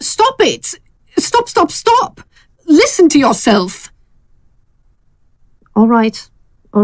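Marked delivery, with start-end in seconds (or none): 0.0-3.9 high, frantic cry of stop; shouting stop. Acted. Fran stops Gail from rushing to cook for Keith, frantic shout. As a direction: cut her off, pleading and sharp.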